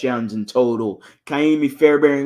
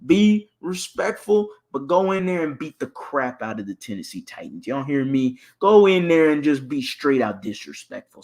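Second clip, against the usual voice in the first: ordinary talk, nothing marked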